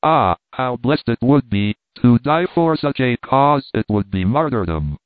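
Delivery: ordinary delivery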